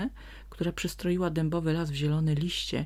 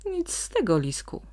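interrupting; 7 semitones higher, 3 dB louder than usual. A narrator's reading. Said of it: "przerwał mu Teofil".

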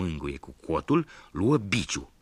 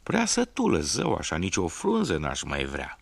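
goat voice; 3 dB louder than usual. Acted. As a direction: sing it out as a smug goat. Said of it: "Și capra răspunse".

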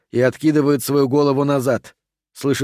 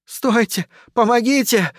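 high-pitched desperate call; crying for help; 9 semitones higher than usual. A narrator's reading. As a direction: plead for rescue, voice way up. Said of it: "кричит Кармен". (отчаянный высокий крик о помощи)

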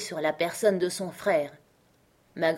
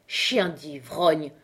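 very slow, lower insult in an odd voice; outraged cry; 3 semitones lower, much slower than usual. Acted. Grandmother scolds drunken Benjamin, slow low insult. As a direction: spit the insult at her drunken brother. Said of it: "s'écria ma grand-mère".

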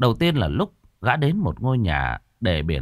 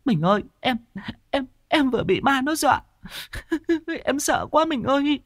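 much higher, sobbing terrified wife voice; crying woman. A narrator's reading. Wife clings to husband, sobbing in terror. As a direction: wail it through tears like a frightened wife. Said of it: "khóc như cha chết".

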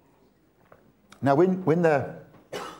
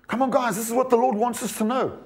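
ordinary talk, nothing marked

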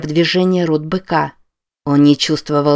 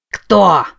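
raised, demanding yell; 3 semitones higher, 4 dB louder than usual. A narrator's reading. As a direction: yell it at the mask. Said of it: "заорал Норман на маску".

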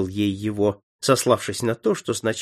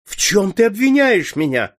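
higher, louder pleading outcry; outraged cry; 8 semitones higher, 6 dB louder than usual. A narrator's reading. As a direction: countess crying out in disbelief at her son. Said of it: "вскрикнула графиня". (возмущённый высокий громкий вскрик)

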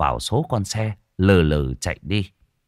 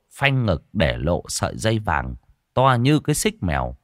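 usual speaking voice

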